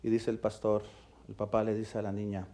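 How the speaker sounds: ordinary talk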